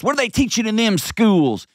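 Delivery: high, sneering parent's whine; mock the griping parents; lampooning a know-it-all parent; mocking complainer. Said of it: "We complain like psychopaths about schools".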